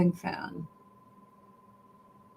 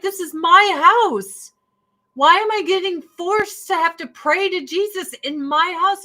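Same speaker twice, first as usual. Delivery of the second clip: high, loud, indignant retelling; angry; 9 semitones higher, 4 dB louder than usual. Retold or imitated. Atheist objecting to prayer in own house, retold indignation. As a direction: protest in a raised voice, leaning hard on key words. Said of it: "I was so angry at God".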